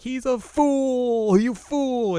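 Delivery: high, loud, stretched fake wailing; mock lament. Friends lament the dead hero, mock wail. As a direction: draw it out as exaggerated lamenting.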